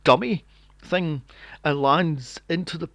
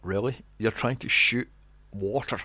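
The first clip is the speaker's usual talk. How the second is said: mock disbelief; 6 semitones lower than usual. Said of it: "I'm going".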